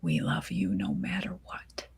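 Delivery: a mother whispering love while hugging. Acted. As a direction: breathe it into her hair. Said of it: "whispers into my hair".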